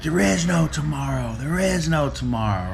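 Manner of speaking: insistent coach. Retold or imitated. Apollo pushing Rocky to fight now, mock urgent. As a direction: drive it home to the fighter. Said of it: "He's like".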